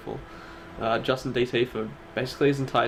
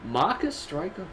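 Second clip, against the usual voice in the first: slow put-on Ace voice; lightly voiced impression of Ace; 6 semitones higher, slower than usual. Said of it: "Do your Ace".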